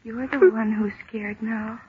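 low, soft, unsettling voice; soft creepy tease; quiet eerie taunt. Acted. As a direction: turn the tables in a hush. Eerie taunt after the fainting.

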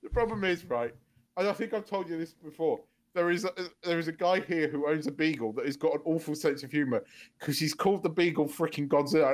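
normal talking voice

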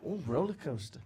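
mild "Oh, roller coaster" of delight; gently appreciative returning visitor; impressed. Retold or imitated. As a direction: savour "roller coaster" like a treat, lightly imitated. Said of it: "you appreciate the seaside".

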